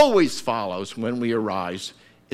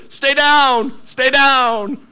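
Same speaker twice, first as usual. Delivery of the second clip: high, loud taunting voice; the enemy taunting; 13 semitones higher, 9 dB louder, much slower than usual. Retold or imitated. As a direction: press him down with your voice. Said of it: "that's what the enemy says to you".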